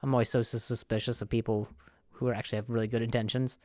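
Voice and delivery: normal talking voice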